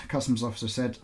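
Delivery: ordinary delivery, nothing marked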